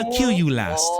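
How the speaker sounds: lightly voiced undead sorcerer; a hint of menacing villain; Lich voice. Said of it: "That's what you hear from the Lich".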